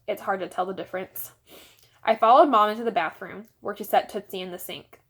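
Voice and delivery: plain reading voice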